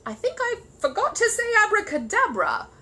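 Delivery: high, loud, faltering bear voice. Acted. Paddington to Mister Curry, high faltering. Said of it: "faltered Paddington".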